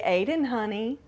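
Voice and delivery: put-on soft, gentle call to a child; calmest voice. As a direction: soothe the boy, softly. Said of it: "in my calmest voice".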